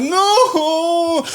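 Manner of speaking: very high drawn-out mock wail; long hammed-up cry of distress; imitated screaming. Friend still freaking out on edibles, mock screaming. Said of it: "he's still like".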